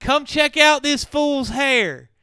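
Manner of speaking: loud, slow, high Southern call; hammed-up Southern holler. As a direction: drag it out like a Southern gossip. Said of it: "Go real Southern".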